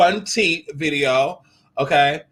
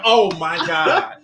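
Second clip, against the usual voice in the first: retold slow oh my god; much slower than usual. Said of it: "I was like".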